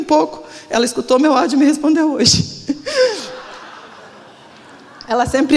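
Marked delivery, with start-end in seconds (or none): 2.5-3.4 shy high laugh. Real, not acted. Laughing at pastor's delayed reply.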